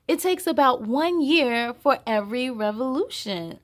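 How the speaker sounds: put-on reading to children. Host reading the picture book aloud to young viewers. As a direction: read it out big and lively for little ones.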